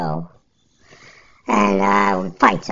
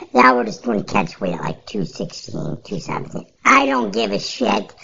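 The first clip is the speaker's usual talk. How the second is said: ordinary talk, nothing marked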